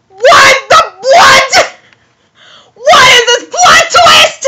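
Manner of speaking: very high, loud disbelieving yell; aghast high-pitched outburst; shocked shriek. Real, not acted. Shocked at the plot twist.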